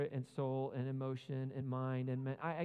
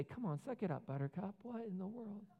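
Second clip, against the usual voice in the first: higher, dismissive mock brush-off; 6 semitones higher, 5 dB quieter than usual. The Jesus he never was, dismissing the weary people. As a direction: brush off their tiredness mockingly. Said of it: "He didn't say".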